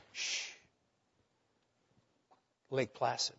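soft, low hush into stillness; hushed shh. Acted. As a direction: drop to a hush as the storm stops. Sudden calm after storm.

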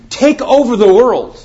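the repeated command, proclaimed high and loud; emphatic repeat. Acted. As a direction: hurl "Take" out like a rallying cry. Repeats command, much stronger.

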